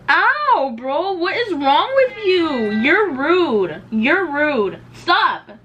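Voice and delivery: high, loud scolding of a pet; shrill outraged complaints at the animal; upset scolding. Real, not acted.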